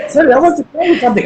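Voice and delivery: quick, lightly voiced little kid; child voice. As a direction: rattle it off like an eager child. Child switching to English.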